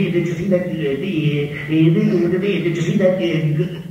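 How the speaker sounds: stuttering cartoon pig, slow and halting; Porky. One guy asking his walking companion, Porky-style stutter. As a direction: stammer excitedly, stretching out the stuck syllables. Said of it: "talked just like Porky".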